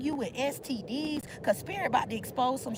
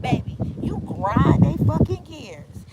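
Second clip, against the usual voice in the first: slow, much louder punchline; loud emphatic; 2 semitones higher, 12 dB louder than usual. Real, not acted. Reader told the rivals fumed, slow loud emphasis. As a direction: drive it home hard and slow.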